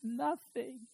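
small, high, soft voice; hushed punchline. Acted. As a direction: answer softly in a tiny high voice. Repeated punchline, answering own question.

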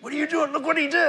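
high-pitched mock protest; retold disbelief, pushed high and loud; incredulous officer. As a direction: act out an outraged partner objecting, voice shooting up. Other officer protests in retold quarrel.

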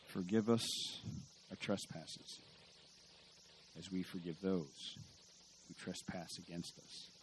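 soft, slow murmured prayer; quiet prayerful quote. Retold or imitated. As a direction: recite it softly, like praying in church. Quoting the Lord's Prayer.

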